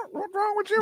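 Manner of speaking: high, soft bird squawk; parrot voice. Acted. Host voices the parrot eyeing the kids, squawky. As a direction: squeak it like a baffled parrot. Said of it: "He just looked at that one eye".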